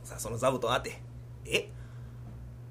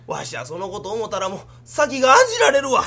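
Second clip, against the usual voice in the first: usual speaking voice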